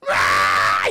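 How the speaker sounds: loud, low gremlin roar; mock battle scream. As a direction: scream it like a wild little fighter. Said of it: "scream at you".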